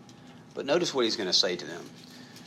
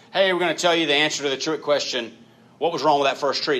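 louder, higher teasing announcer voice; mock game-show reveal; 5 semitones higher, 6 dB louder than usual. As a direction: tease it like a trick-question reveal. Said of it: "it's like".

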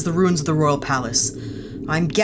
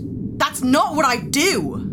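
indignant; 10 semitones higher, much slower than usual. Acted. Heated quarrel over promise.

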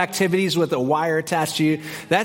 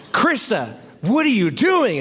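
horrified; 7 semitones higher than usual. Retold or imitated. Looks at wife in horror.

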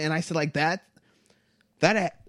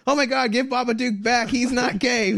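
higher, louder hammed-up panic; mock freak-out; 9 semitones higher, 4 dB louder than usual. Imagining the right freaking out over Babadook, hammed-up panic. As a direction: ham up a frantic fan begging for his monster back. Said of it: "No one's freaking out".

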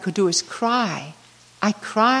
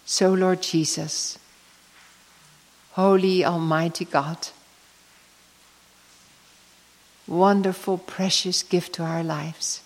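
hushed, lower, unhurried prayer with long pauses; closing prayer; 4 semitones lower, 4 dB quieter than usual. Real, not acted. Speaker closes her talk by praying to Jesus, hushed prayer. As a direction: pray softly and reverently, letting each phrase settle.

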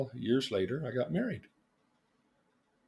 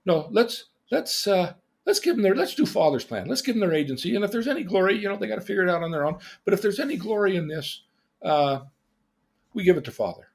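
higher, earnest pleading brother; imitating an eager objection, pitched up; Jesus; 7 semitones higher, 3 dB louder, faster than usual. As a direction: protest gently, tumbling over the words. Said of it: "And his brother said".

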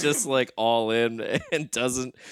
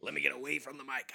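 whisper; 2 semitones higher, 14 dB quieter, much faster than usual. Real, not acted. Told he's too loud.

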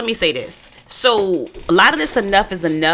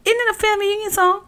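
high mock voice; 14 semitones higher, faster than usual. Teasing about a reunion song.

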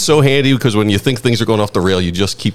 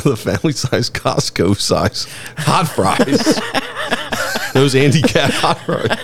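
laughing through the words; 5 semitones higher, slower than usual. Real, not acted. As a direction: giggle it out. Laughing at fries.

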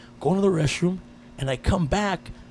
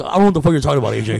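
loud mock exasperation; retold loud, comic bafflement; baffled outburst; 8 dB louder, much faster than usual. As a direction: blurt it out loudly, playing totally lost.

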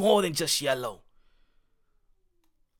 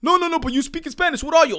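high, raised-voice challenge; insistent questioner; 10 semitones higher, 5 dB louder than usual. Acted. Someone challenging him for speaking Spanish, insistent and high. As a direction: press him in a higher, insistent voice. Acted challenge about his identity.

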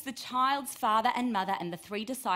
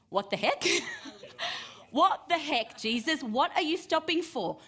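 louder and higher, exasperated at the delay; frustrated outburst; 8 semitones higher, 4 dB louder than usual. Pretend. Worried father snaps at Jesus for stopping. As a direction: demand an answer from Jesus, fed up. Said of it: "Would you be thinking".

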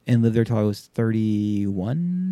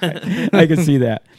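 slow, higher, laughing; 6 semitones higher, 5 dB louder than usual. Real, not acted. Reacting to the clip joke, laughing.

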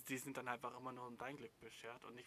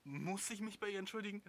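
much higher, mocking whine; host quoting the viewer in a much higher mocking voice; mocking quote; 8 semitones higher than usual. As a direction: parody the clueless question. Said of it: "dann jemanden fragt, ob".

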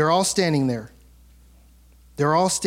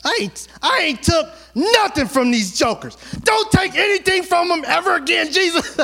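hammed-up indignant outburst at the Lord; mad Moses; 11 semitones higher than usual. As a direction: shout it up high like an indignant man half-laughing. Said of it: "He was mad".

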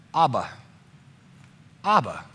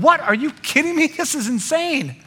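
high, incredulous outburst; shocked crowd; 10 semitones higher than usual. Acted. Crowd hearing the father forgive his son, stunned disbelief. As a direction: gasp in disbelief at the ending. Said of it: "imagine all the crowd going".

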